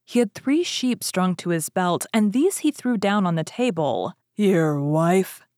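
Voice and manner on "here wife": ogre's call. A narrator's reading. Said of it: "he cried".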